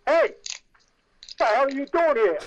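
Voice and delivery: loud, slow, high-pitched demand; acted shout at an intruder; guard's challenge. Prison guard to stray actor in outfit, challenging shout.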